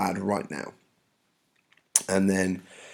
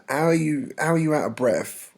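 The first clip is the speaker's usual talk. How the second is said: higher-pitched mock disbelief; incredulous; 7 semitones higher, 3 dB louder than usual. Juliet doubting the nurse is breathless, incredulous. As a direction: question it as if it's absurd.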